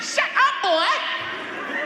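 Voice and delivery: very high, loud order; shrieked motherly command; furious mother. Retold or imitated. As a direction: play a mama at the end of her patience. Mother repeating her order.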